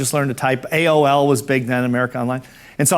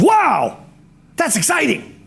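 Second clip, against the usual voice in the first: high, gushing cheery excitement; John Spanath; 10 semitones higher, slower than usual. Retold or imitated. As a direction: gush like a very cheery guy. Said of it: "that's how he sounded. He was a very cheery guy".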